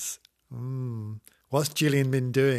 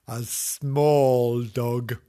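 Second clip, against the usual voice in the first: very slow, stretched; slow emphasis; much slower than usual. Acted. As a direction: draw out small dog.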